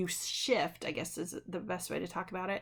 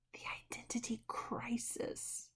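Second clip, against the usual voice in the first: hushed term; 3 semitones higher, 9 dB quieter than usual. Shown as a demonstration. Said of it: "This is what we call".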